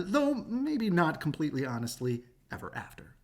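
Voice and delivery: plain reading voice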